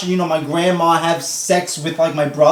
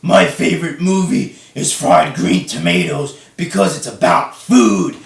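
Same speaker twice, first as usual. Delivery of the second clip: mock Ryback voice. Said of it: "he talks in Ryback's voice".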